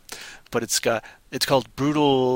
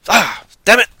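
loud, higher exasperated outburst; mock frustration; 7 semitones higher, 9 dB louder than usual. Stressed guy when his Walkman dies, loud mock annoyance. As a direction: blow up like the annoyed beachgoer.

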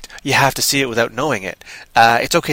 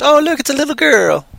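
pitched way up, light and airy; high mock voice; 11 semitones higher than usual. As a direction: squeak it out like a cameo line.

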